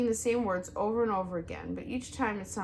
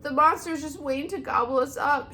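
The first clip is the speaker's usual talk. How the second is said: higher, louder frightened shriek; 8 semitones higher, 8 dB louder than usual. A narrator's reading. Groundhog shrieking before fleeing, higher and louder. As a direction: shriek it in terror. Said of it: "shrieked Dig Down".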